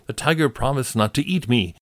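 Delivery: high, pleading call for help; pleading jackal. Acted. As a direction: beg the hare to judge. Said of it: "the jackal called out to him".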